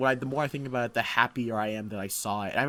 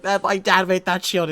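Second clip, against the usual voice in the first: loud, high upset cry; hammed-up hurt shout; upset outburst; 6 semitones higher, 9 dB louder than usual. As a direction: shout it like it hurts. Tony retold, upset.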